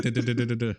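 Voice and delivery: quick string of "duh" noises; imitated fight effect. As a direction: mimic rapid moves with repeated duhs. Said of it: "Like, with his hands".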